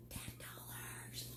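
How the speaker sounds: slow whispered "Ten dollars" like a secret. Real, not acted. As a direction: breathe the price out in a whisper. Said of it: "I got excited there for a second".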